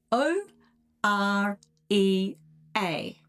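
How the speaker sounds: slow, separate letters; spelling out. Shown as a demonstration. Host spelling a made-up word for listeners, slowly spelled out.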